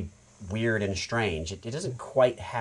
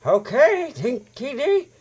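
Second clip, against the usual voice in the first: Chum Bucket voice; 12 semitones higher, 7 dB louder, slower than usual. Retold or imitated. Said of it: "And I'm like".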